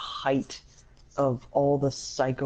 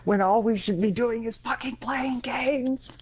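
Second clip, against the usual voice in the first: high-pitched exasperated outburst; 9 semitones higher than usual. Real, not acted. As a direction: plead for sense, pitch raised throughout. Exasperated summing up of the feud.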